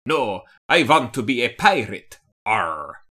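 pirate voice answering the question; mock pirate. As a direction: play a cartoon pirate, half-joking. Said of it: "Not doing a Russian accent".

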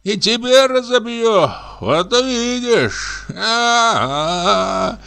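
put-on high, slow, drunken rant about the room; drunk comic raving. A narrator's reading. Drunk comic rants at the spinning room, high and slow. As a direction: reel through it like a paranoid drunk.

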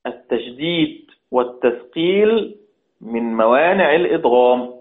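ordinary talk, nothing marked

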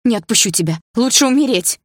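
loud, rising defiant cry; firm refusal. A narrator's reading. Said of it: "твёрдо сказала я". (твёрдо и громко, срываясь на крик)

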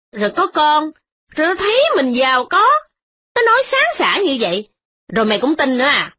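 high, scornful rebuke; scolding snap. A narrator's reading. Old mistress scolding her noisy daughter-in-law. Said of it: "Bà cai nạt rằng".

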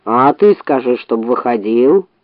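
low, slow bear grumble; grumbling bear. A narrator's reading. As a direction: grumble it lazily. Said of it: "проворчал медведь".

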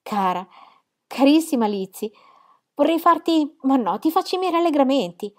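high, loud rush of delight; joyful. Acted. Jane congratulates her sister, high and loud with joy. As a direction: burst out with happiness.

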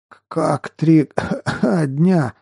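slow, stammering, coughing mid-question; choking on water. A narrator's reading. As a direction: sputter and cough while drinking. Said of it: "едва не захлебнувшись". (захлёбываясь, с кашлем)

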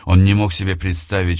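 usual speaking voice

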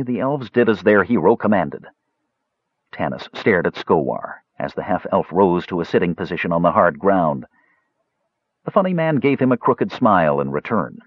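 ordinary delivery

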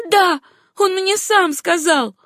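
high, slow, emphatic answer; defiant insistence. A narrator's reading. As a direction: throw the claim back defiantly. Acted quarrel, defiant answer.

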